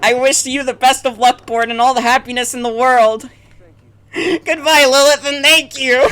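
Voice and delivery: a hint of crying at the end; lightly voiced Lilith farewell.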